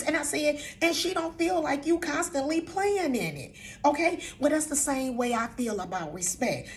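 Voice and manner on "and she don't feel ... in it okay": high-pitched, insistent telling-off; raised, emphatic retold scolding; stern reprimand. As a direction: scold a grandchild on someone's behalf. Retelling her scolding of grandson.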